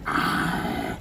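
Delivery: put-on deep, slow, menacing old emperor. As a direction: purr it like a sinister emperor. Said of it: "el emperador hablaba así".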